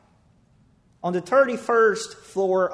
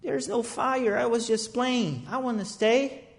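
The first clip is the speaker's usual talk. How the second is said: put-on child's voice; 5 semitones higher, 6 dB quieter than usual. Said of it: "him saying to you".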